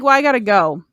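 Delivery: mock casual goodbye; breezy exit line. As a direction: slip away from the prince. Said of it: "she's like".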